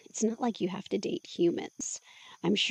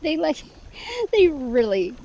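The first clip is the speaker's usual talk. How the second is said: higher, louder words squeezed out between giggles; laughing protest; 4 semitones higher, 6 dB louder than usual. Acted. Woman tickled by jets, loud laughing protest. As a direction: fight through the laughter to get it out.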